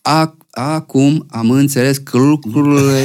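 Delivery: slow, stumbling mock-drunk singing of the line; imitating drunk slurring. Tipsy singer re-enacts failing his studio take, slow slurred singing. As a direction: stretch it out like a tipsy singer who can't get the word out. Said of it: "nu puteam să zic lucrurile".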